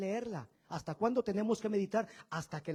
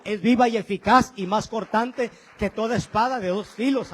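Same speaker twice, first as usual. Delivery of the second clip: 1 preaching proclamation; 9 dB louder than usual. Real, not acted. Preacher proclaims scripture loudly.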